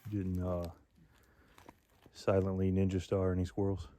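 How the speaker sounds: loud teasing call to a friend. Real, not acted. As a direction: shout across the distance, joking. Calling out to distant friend.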